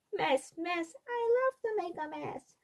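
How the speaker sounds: put-on high, soft singsong child voice; gleeful toddler. A narrator's reading. Fudge at the sink, gleefully chanting about mess in a high child voice. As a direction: croon it like a delighted little kid.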